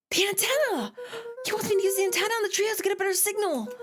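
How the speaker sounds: high, fast and eager; excited realization. Acted. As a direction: it all clicks at once. Sudden realization.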